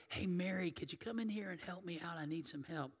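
soft, hushed request for help; quietly asking. Retold or imitated. Martha quietly asking Mary to help, hushed and soft. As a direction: ask her sister gently, under the breath.

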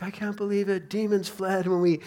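acted excitement, mildly breathless with wonder at the news; excited. Disciples reporting back to Jesus after confronting demons, excited.

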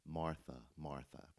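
soft, gentle repeat of the name; tender calling of name. Retold or imitated. Jesus gently calling Martha by name. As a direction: call her name twice, softly and kindly. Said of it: "he says her name twice".

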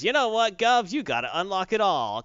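high, loud cockney-ish taunt; hammed-up London geezer voice; mock British villain. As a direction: sneer it like a cockney crook.

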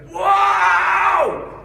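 very high, loud prophetic shout; sudden booming whoa that stops the room. Retold or imitated. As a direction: bellow it out across the room. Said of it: "I won't do it as loud as he did".

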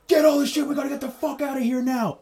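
high, strained mock yell; panicked getaway command; frantic order. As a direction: imitate a guy urgently yelling at accomplices, volume held back. Acting out man urging getaway.

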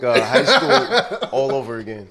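chuckling through the words; laughing as he talks. Real, not acted. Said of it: "laughter is contagious".

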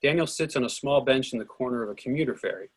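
ordinary talk, nothing marked

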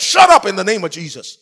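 loud, forceful rebuke; commanding shout. Retold or imitated. Man desperately rebukes a demon, commanding shout. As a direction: bellow an order to silence someone.

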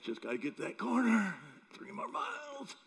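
high, strained push to keep going; mock exhausted effort; straining jogger. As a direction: squeeze it out climbing in pitch.

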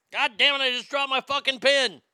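normal talking voice

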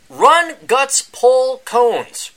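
slow, high, booming title call; grand title announcement. Acted. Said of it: "That is the new book out by Adam Piggott".

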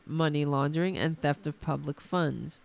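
ordinary delivery

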